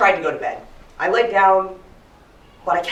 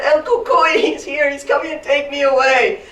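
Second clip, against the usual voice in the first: high, loud frightened wailing; panicked child; 11 semitones higher, 8 dB louder than usual. Acted. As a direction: cry out as if being carried off. Acts terrified child crying for help.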